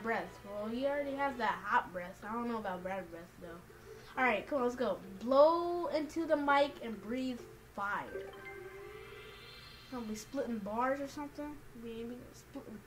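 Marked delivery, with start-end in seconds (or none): none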